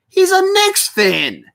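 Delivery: high, drawn-out exasperated outburst; heated. Real, not acted. Streamer fed up with a rival's Lakers streams, heated. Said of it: "I'm getting so heated over this".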